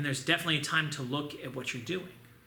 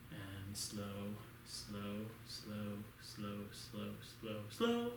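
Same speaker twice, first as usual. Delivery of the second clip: low, soft, drawn-out slow, slow; slow count; 7 semitones lower, 11 dB quieter, much slower than usual. Shown as a demonstration. Instructor crawling the count to slow students. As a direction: sink down and drag each slow.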